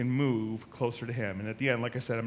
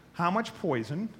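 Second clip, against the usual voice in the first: ordinary delivery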